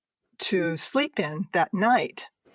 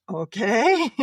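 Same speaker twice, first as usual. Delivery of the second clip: a higher, louder, startled okay; sheepish okay; 4 semitones higher, 5 dB louder than usual. Retold or imitated. Told Mom is mad, she answers in a sheepish okay. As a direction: take the scolding with a guilty grin. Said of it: "And I said".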